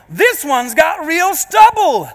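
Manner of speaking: slow, high mock exclamation; exclaiming tourist lady. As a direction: announce an amazed discovery to the group. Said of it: "exclaiming to everyone".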